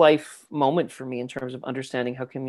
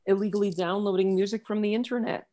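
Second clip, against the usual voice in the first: patronizing sweetie; 8 semitones higher than usual. Retold or imitated. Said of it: "I said".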